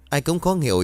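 ordinary delivery, nothing marked

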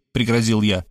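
ordinary delivery, nothing marked

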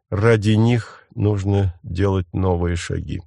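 usual speaking voice

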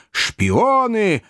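high-pitched accusing yell; mob yell. A narrator's reading. Crowd yelling at the Pickwickians, high accusing yell. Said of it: "орала толпа".

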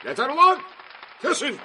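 very high, loud yell; furious high-pitched command; angry shout. A narrator's reading. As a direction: bellow at the crowd to stop. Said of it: "diye bağırdı".

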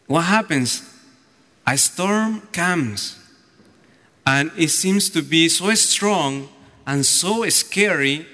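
ordinary talk, nothing marked

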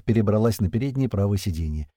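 plain reading voice

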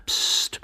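short voiceless "psst"; shushing hiss. Acted. Young man silences his trembling companion, hissing. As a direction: hush him sharply. Hidden man hushes the other.

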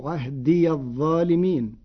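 "عهدي الظالمين" joined as "ahdiya dh-dhalimin"; fatha on yaa. Shown as a demonstration. Teacher showing Warsh's joined reading, "عهدي" said ahdiya. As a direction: sound the ي with a short "a" into the next word in "عهدي الظالمين". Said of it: "بفتح الياء وصلا".

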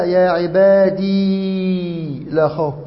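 عبادي stretched very long on its final ee; sustained ya. Shown as a demonstration. Teacher demonstrating the still ya, long held ee in عبادي. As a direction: hold the ee of عبادي far beyond normal, then go on. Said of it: "بإثبات الياء ساكنة".

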